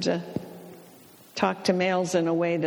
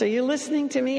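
higher-pitched, pointed question; retold wifely check; 6 semitones higher, much faster than usual. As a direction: ask higher, checking he is listening. Wife retold checking on husband.

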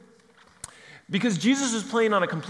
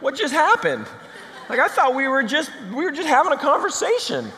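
high, laughing bewilderment; high laughing what-just-happened; laughing disbelief; 11 semitones higher, 5 dB louder than usual. Retold or imitated. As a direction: laugh it out, caught off guard. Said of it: "and he'd be like".

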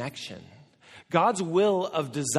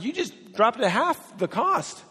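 mock disbelief; 7 semitones higher than usual. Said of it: "I'm like".

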